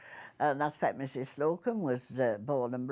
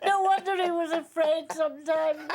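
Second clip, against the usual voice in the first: talking through laughter, higher and louder; laughing; 12 semitones higher, 6 dB louder than usual. Real, not acted. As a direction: laugh the words out.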